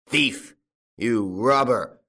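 loud, drawn-out accusation; enraged old farmer; furious yell. A narrator's reading. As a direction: yell it at a thief. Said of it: "yelled old Goussot".

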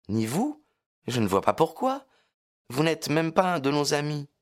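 put-on high teasing girl voice; young girl voice. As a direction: tease him lightly as Lucie. Lucie teasing. (voix de jeune fille taquine)

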